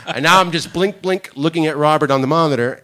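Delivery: loud, high, wide-eyed retelling; loud comic dismay; animated telling. Real, not acted. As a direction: play up the frozen blink. Retelling the awkward stare.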